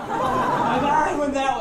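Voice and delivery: high laughing yell; laughing shout. Retold or imitated. Laughing, pointing the way.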